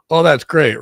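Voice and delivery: ordinary delivery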